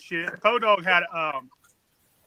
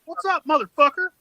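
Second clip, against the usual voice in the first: high, cocky challenge; challenging; 8 semitones higher, 4 dB louder than usual. Retold or imitated. Taunting the coworker who threatened to cut him, high and loud.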